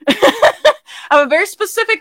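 real laughter breaking through; high laugh. Real, not acted. Speaker laughing about her big personality.

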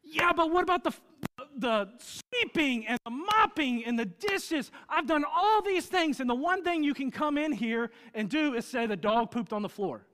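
high, loud, indignant protest; defensive retort. Retold or imitated. Husband snapping back at his wife over the dog, indignant. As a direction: list your chores, aggrieved. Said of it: "And I'm like".

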